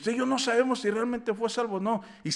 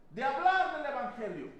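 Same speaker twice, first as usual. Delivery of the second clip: ordinary delivery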